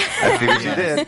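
high laughing. Real, not acted. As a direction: squeal it out amused. Laughing at a pun.

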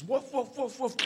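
high, repetitive woofs; dog woofing on. Acted. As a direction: keep up a mechanical bark. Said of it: "the dog carries on woofing".

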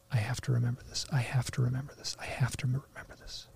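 hushed repetition fading to near silence; whispered self-reminder. Retold or imitated. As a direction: whisper it to yourself, trailing away. Urging himself while floating away.

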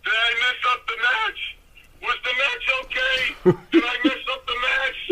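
mock yell, pitched well up; retold yelling. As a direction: call it out high, re-enacting a frantic yell. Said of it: "I'm yelling on the way back".

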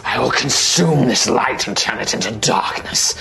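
menacing monster threat. Acted. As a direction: growl it as a dark creature. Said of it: "It's like".

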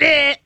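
high buzzing "eh"; imitated electric zap. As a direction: mimic a shock collar going off.

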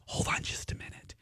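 hushed, conspiratorial whisper; quietly sneaking a favour; whispered aside. Retold or imitated. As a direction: whisper it like a secret. Flight attendant's secret aside.

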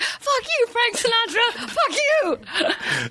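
high-pitched mock karaoke-room yell; imitated yelling. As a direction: mimic a drunken heckler yelling, pitched high. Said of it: "yelling in the karaoke room".

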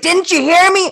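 loud, high-pitched retort; hammed-up shout of an unheard husband; mock exasperated shout. Wife imitating her husband's complaint, mock indignant shout.

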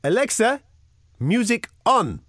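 high, slow voice-command imitation; demonstrating Alexa. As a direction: mimic someone proudly commanding a smart speaker. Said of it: "to demonstrate Alexa to me".

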